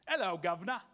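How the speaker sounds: mock British accent with a laugh; a jokey British greeting; a laughing try at a British accent. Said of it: "You didn't think a Mexican could make that accent".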